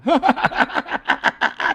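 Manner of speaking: high, rapid staccato ha-ha-ha laugh; demonstrated laugh. Shown as a demonstration. User showing assistant how to laugh, high exaggerated laugh. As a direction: fake a long, high tickled laugh. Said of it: "You have to laugh at the end".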